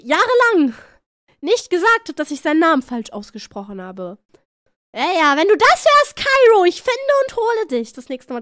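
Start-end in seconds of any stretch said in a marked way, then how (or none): 4.9-8.4 high, loud playful threat; mock threat. Streamer threatening a friend over a name, mock-angry. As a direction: threaten them in mock outrage.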